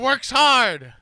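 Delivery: high, loud announcer shout; excited commentator impression. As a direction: join in hollering like a sportscaster. Joins in the commentator imitation.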